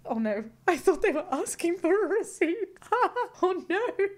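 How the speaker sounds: high, loud giggly horror; laughing cringe. Real, not acted. Reacting to a viewer's story, laughing cringe. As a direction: laugh through the secondhand embarrassment.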